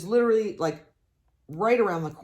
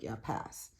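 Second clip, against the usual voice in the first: dismissive; 6 semitones lower, 12 dB quieter than usual. Retold or imitated. Dismissing the heat, quoted self.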